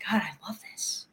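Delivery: whispered quote of a delighted thought; hushed delight. Retold or imitated. Fan recalling the video of dancing ushers, hushed delight. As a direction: murmur it under the breath, relishing it.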